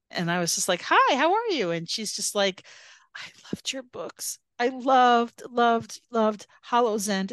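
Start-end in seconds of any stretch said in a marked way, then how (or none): 0.9-1.7 high-pitched, chirpy hello; retold eager greeting. Retold bright greeting to a fan. 3.2-4.4 hushed, breathy confession; soft, airy admiration; retold awed fan. 4.6-7.3 retold gushing fan.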